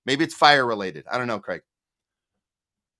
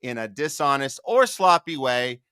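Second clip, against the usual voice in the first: raised, punchy show-host delivery; news anchor read; 6 semitones higher, 3 dB louder than usual. Acted. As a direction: announce it like a TV news opener. Opens news segment as host.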